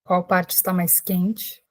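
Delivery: unhurried calming voice with long pauses; guided meditation. Real, not acted. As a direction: guide listeners gently into breath and body, leaving space after each phrase. Leads a guided breathing meditation.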